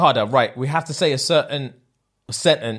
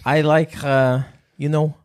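put-on Scouse, feeling it out; Liverpool accent. Podcaster showing how the challenge goes, Liverpool accent. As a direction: recite it like a Scouser. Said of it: "We'll go Liverpool".

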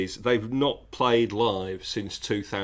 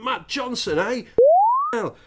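mock drunk shout; 14 semitones higher, 6 dB louder than usual. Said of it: "shouted in a loud voice".

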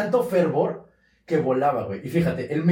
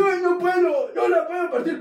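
high, pleading little voice; mock-helpless monk; whiny saint; 11 semitones higher than usual. Saint voiced struggling with host.

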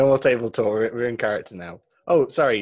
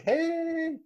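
excited hey; 4 dB quieter than usual. Retold or imitated. Flirty greeting across the courthouse.

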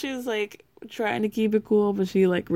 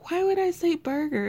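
laughing; 10 semitones higher than usual. Retold or imitated. Voicing Amani amused.

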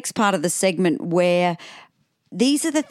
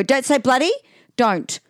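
lightly voiced bossy child, pitched up; Summer; 7 semitones higher than usual. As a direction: imitate a kid correcting mum like a little drill sergeant. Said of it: "She's like the drill sergeant".

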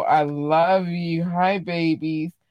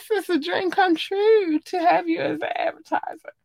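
high-pitched gushing; 13 semitones higher than usual. Pretend. Podcaster gushing to dream advertiser [name], high-pitched squeal. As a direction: squeal it like a starstruck fan.

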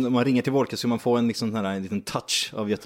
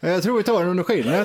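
higher-pitched teasing Gothenburg lilt; mock Gothenburg accent; 6 semitones higher than usual. Co-host parrots his colleague's line, teasing Gothenburg accent. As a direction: mimic him with a touch of Gothenburg accent. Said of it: "Lite göteborgska där också".

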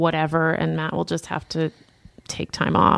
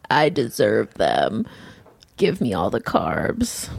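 low voice; 9 semitones lower, slower than usual. Retold or imitated. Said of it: "'cause I was like".

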